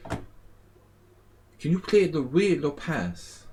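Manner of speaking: slow, high put-on Spanish accent. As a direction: imitate a Spaniard, drawing every word out. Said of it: "Like a real Spaniard would say it".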